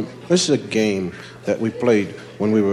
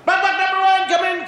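much higher game call; kid calling out. Retold or imitated. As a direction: yell the call to the next kid. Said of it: "They start sending kids down".

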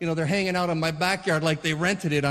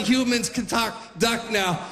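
ordinary delivery

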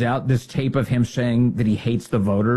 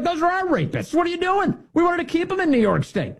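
much higher, indignant squeal; mock outraged Democrats. Hochul's side complaining about arrests, high indignant voice. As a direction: protest in a high, put-out voice. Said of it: "Those are like".

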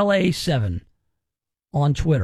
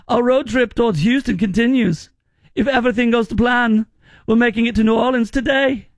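higher-pitched, hammed-up German accent; mock German accent; 4 semitones higher, 3 dB louder than usual. Host reading a German visitor's travel post, mock German accent. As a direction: imitate a German tourist, pitched up. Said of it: "you should say in a German accent".

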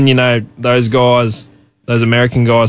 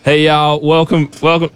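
higher-pitched put-on American accent; 4 semitones higher than usual. Shown as a demonstration. Australian singer attempting it for the radio host, put-on American accent. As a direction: try out a folksy American accent. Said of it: "give me that American accent one more time".

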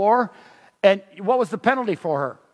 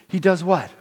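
normal talking voice

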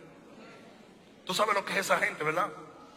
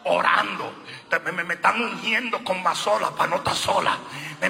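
usual speaking voice

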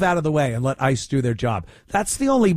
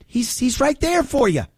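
ordinary talk

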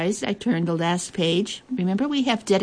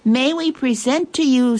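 lifted, higher-pitched proud presentation; proud and pleased; 7 semitones higher, 4 dB louder than usual. A narrator's reading. Queen presents her gift to the traders, proud and pleased. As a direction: present it with a touch of pomp and pleasure. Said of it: "bursting with pride and pleasure".

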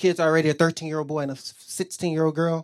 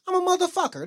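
squeaky punchline; 14 semitones higher than usual. Acted. Stepdad punchline to kids.

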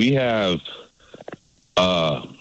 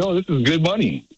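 higher-pitched mimicking of Canadians; retold insistence; 8 semitones higher than usual. Canadian passing off dollars in Yuma, retold insistence. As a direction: imitate someone insisting, pitched up.

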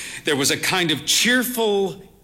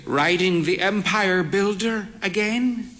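put-on frail old mother; 5 semitones higher than usual. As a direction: play the bird-faced old mother, cautious. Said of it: "she said".

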